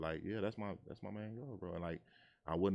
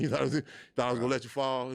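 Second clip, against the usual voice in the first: louder, higher, faster teasing voice of God; 8 semitones higher, 11 dB louder, faster than usual. Retold or imitated. God scoffing at a believer who thought he'd fall, loud. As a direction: tease like God scoffing at your doubt. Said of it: "looking at you like".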